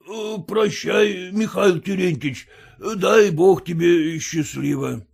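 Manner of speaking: медленно, тянуще бормоча; бормочи прощание, устало. A narrator's reading. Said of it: "бормотал староста". (усталое бормотание)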